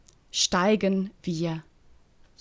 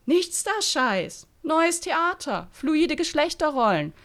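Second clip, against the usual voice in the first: smug; 9 semitones higher than usual. Acted. Bertram lecturing smugly.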